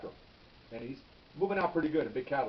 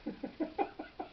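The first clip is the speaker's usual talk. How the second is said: short unvoiced laugh; a quick laugh at the joke; breathy laugh; 6 dB quieter than usual. Real, not acted. Punchline about the name tag.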